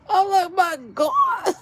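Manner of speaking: high, laughing cry of shock; cracking up in disbelief. Real, not acted. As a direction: squeal it out laughing. Reaction retold while laughing.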